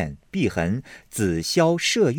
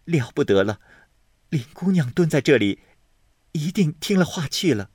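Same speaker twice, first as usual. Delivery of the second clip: a touch of panic, higher; mild alarm; 6 semitones higher than usual. Acted. Hongyu fears Daiyu overheard them, mildly alarmed.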